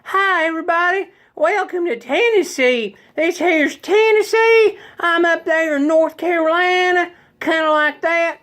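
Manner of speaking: very high-pitched Tennessee twang; exaggerated high Tennessee accent. Shown as a demonstration. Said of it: "Tennessee accents are a little bit higher".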